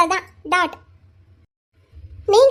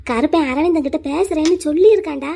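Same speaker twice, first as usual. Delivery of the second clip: ordinary talk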